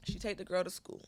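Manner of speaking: ordinary talk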